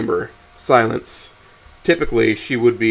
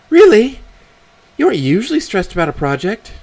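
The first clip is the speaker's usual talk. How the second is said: high-pitched mother, surprised opening; questioning mother; 11 semitones higher, 6 dB louder than usual. Acted. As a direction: question your daughter in a high voice. Voices mother questioning her daughter.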